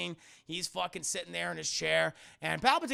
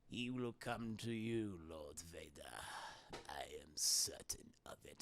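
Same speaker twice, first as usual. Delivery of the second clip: Palpatine; 13 semitones lower, 15 dB quieter than usual. Acted. Emperor assuring Vader, low sinister whisper.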